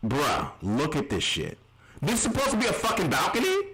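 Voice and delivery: ordinary delivery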